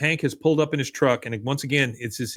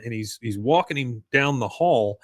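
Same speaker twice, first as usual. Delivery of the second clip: ordinary talk, nothing marked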